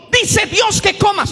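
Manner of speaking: the wrong way, a loud blunt order; mock shouted command. Shown as a demonstration. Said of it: "La serpiente no gritó".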